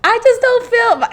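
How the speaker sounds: high-pitched pleading self-imitation. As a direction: put on a high, needy little voice. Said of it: "I'm in this voice".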